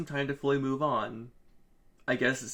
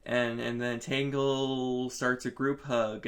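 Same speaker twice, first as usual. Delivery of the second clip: ordinary delivery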